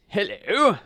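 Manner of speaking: high, loud storybook hello; hungry dog hailing a stranger; dog greeting. A narrator's reading. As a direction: call a bright-pitched greeting.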